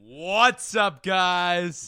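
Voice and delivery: slow stadium announcer welcome. Acted. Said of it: "stadium announcer voice when you gotta give it more".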